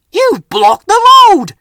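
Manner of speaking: high, loud, slow snap; angry Bertie. A narrator's reading. Bus snapping at the trucks blocking him, loud. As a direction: bark it out crossly. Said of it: "he snapped".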